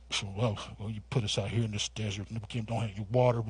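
low, soft grumbling murmur; grumbling under the breath. Retold or imitated. The grumbling Israelites murmur low under their breath. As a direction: mutter it low like a sulky crowd. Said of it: "It paints the picture of this".

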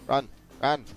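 ordinary talk, nothing marked

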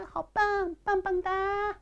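very high, cutesy put-on praise; pretend cheering. Pretend students praising teacher's reading, high-pitched cheering. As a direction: squeal mock applause in a tiny high voice.

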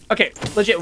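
usual speaking voice

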